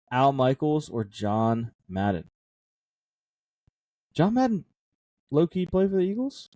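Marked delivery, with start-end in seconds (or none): 4.1-6.5 surprised. Real, not acted. Surprised at trivia answer.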